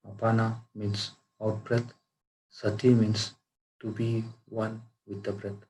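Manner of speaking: guided meditation. Acted. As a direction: guide them into calm, unhurried, phrase by phrase. Leads a breath meditation.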